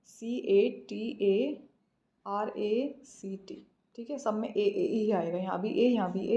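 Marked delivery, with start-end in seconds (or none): 0.1-3.7 slow, letter by letter; deliberate dictation of each letter; spelling out. Shown as a demonstration. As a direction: spell it out slowly with gaps between letters. Dictates the correct spelling to students.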